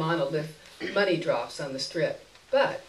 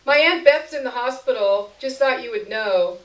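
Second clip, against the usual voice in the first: higher, louder, pushy chatter; Jerry through the door; 6 semitones higher, 8 dB louder than usual. A narrator's reading. Jerry to narrator behind the door, louder persistent chatter. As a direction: keep talking to get her to answer.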